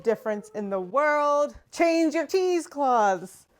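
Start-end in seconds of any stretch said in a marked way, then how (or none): 1.7-3.5 slow, loud, higher "change your cheesecloths"; emphatic advice. Real, not acted. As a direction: lay down the lesson word by word. Urging viewers after clean cloth works.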